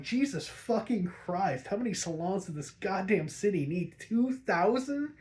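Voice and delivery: exasperated disbelief. Real, not acted. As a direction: stretch out Two thousand in disbelief. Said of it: "I'm like".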